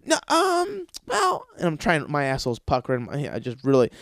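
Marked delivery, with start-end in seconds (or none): 0.1-1.5 fake hesitation. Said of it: "I'm like".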